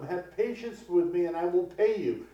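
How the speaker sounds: a fellow servant pleading for time; earnest plea for mercy. Acted. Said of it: "pleaded with him".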